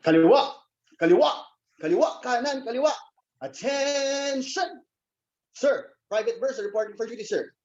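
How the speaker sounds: march in place barking orders; stiff barking toy soldier. Acted. Military drill marching and report.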